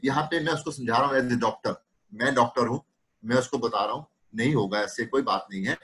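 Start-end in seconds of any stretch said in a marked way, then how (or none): none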